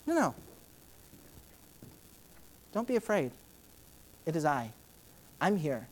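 soft, gentle comfort; reassuring. Retold or imitated. Jesus soothing the frightened disciples, soft and gentle. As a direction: hush them like a calm father.

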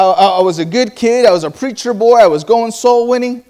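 ordinary talk

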